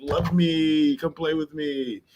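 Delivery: slow, whining puppy plea; asking his girlfriend to play, whining like a puppy; puppyish plea. Retold or imitated. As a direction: beg like a needy pup. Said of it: "I just be like".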